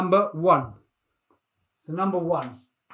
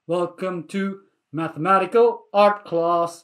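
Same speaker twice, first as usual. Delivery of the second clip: slow, measured class welcome; ceremonial welcome; 2 semitones lower than usual. Acted. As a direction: space out each word like a grand title.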